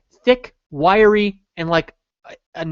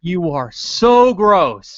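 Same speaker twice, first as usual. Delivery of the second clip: slow, louder mock revulsion; drawn-out disgust; 5 dB louder, much slower than usual. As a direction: lean loudly into the drawn-out disgust. Repeats the disgusted stare thought.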